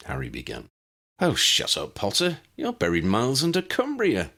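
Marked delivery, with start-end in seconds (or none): 1.2-4.3 joking. A narrator's reading. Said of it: "Dennis joked".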